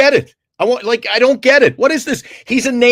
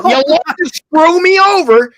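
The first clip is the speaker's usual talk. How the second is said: mock indignant; 9 semitones higher, 3 dB louder than usual. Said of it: "and what do I say?".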